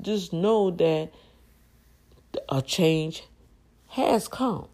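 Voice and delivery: slow and emphatic, a change has come; stressed proclamation. Real, not acted. Telling her viewers a change has come, emphatic. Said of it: "Not is coming".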